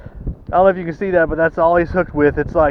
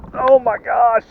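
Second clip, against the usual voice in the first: excited; 7 semitones higher than usual. Real, not acted. Landing a personal best fish.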